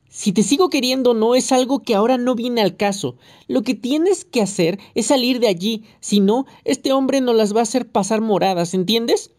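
mild nagging yell; shouting. A narrator's reading. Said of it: "no hacía más que gritar".

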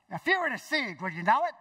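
high, punchy imitation of a friend; friend's way of talking. Friend challenges Bob about deception, in imitated voice. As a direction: put on the friend's voice, rising at the end. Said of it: "this is how he talks".

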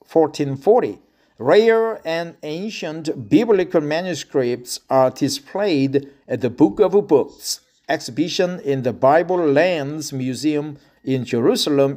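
ordinary talk

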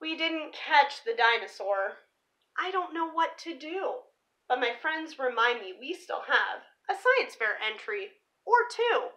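lively picture-book read-aloud; story narration. Acted. Reading a dinosaur picture book to viewers, storybook voice. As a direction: read the rhyming story to the kids.

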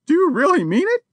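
the lady crying out to the burglar, high and afraid. A narrator's reading. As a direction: plead in sudden alarm. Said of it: "she cried".

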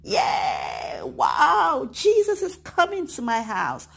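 excited shout of joy; shouting wow with delight; happy. Acted. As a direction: cheer like a thrilled child.

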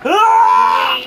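high frightened shout; panicked scream. Real, not acted.

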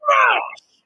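high shouted battle cry; hammer shout. Real, not acted. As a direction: scream it as you strike.

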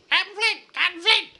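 high, loud squawking parrot cry; pirate parrot voice. Pretend. Pirate's parrot squawking its captain's name, high screech. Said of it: "that would say".

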